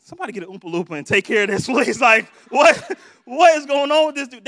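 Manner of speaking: lightly played loud exasperated aside; Willy Wonka. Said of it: "Willy Wonka looked at Veruca Salt like".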